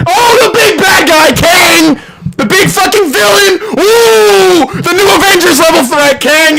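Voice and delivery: scornful shrieking rant; sarcastic shouting. Real, not acted. Mocking the villain's big build-up.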